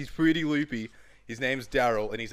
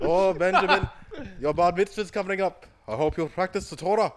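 higher, louder doting elder; 6 semitones higher, 5 dB louder than usual. Pretend. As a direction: fuss over Benjamin like a grandfather.

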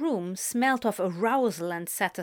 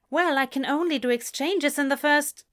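shopkeeper voice; 6 semitones higher, 3 dB louder than usual. Acted. Jan explaining policy.

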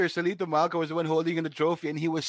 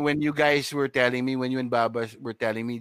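ordinary talk, nothing marked

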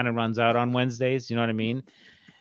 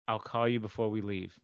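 softer, low, understated phone reply; low-key son; 4 semitones lower, 10 dB quieter than usual. Retold or imitated. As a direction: say it quietly and matter-of-fact. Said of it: "that's me. I'm just like".

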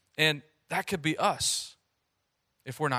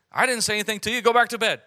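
a bit higher and louder, irritated; annoyed priest; 6 semitones higher, 6 dB louder, faster than usual. Retold or imitated. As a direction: wave the boy off, half annoyed. Eli woken by boy at night.

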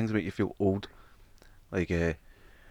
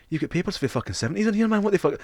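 higher-pitched mock scold; scolding cleaner; 10 semitones higher than usual. TV cleaner scolding hoarder Davey, higher-pitched mock disbelief.

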